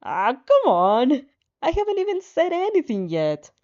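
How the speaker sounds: high, loud complaint; loud, high gamer protest at losing points; exasperated protest. Real, not acted. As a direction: whine that it isn't fair. Reader reacts to losing points.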